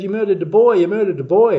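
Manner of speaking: loud, high mock accusing shout; retold angry accusation. Angry crowd to the street performer, acted-out accusing shout. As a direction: throw out the challenge like an outraged mob.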